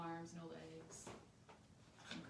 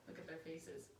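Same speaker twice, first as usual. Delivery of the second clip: ordinary delivery, nothing marked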